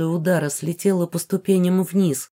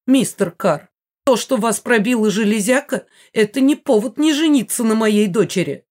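raised, insistent scolding; stern mother; 4 semitones higher, 5 dB louder than usual. A narrator's reading. Mother demands the wounded man marry her daughter, stern. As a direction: lay down the law, high and loud. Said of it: "строго произнесла миссис Джоун".

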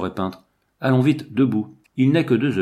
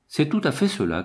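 mildly raised, higher-pitched exclamation; exclaiming; 6 semitones higher than usual. A narrator's reading. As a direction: cry out in recognition, without shouting. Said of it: "cria Lucien". (s'exclamer, d'une voix plus aiguë)

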